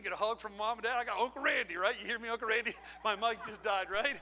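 normal talking voice

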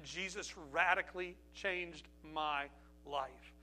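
slow and deliberate, pausing between words; slow, weighty testimony. Real, not acted. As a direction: draw out each word.

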